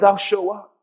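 soft, low quiet promise; steady comfort to the listener; lightly voiced gentle Jesus; reassuring Jesus. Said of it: "He says".

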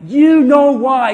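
loud, drawn-out retort; slow it down and boom it; forceful rebuke. Real, not acted. Preacher answering the complainer, loud slow rebuke.

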